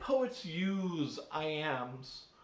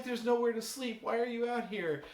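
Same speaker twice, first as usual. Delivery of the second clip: higher, puzzled horse voice; voicing the horse; 7 semitones higher than usual. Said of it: "The horse is like".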